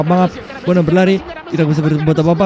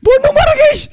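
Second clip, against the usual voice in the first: high, loud panicked wail; high whining alarm; panicked cry; 3 dB louder than usual. Real, not acted. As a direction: shriek it in panic. Friend attacking him.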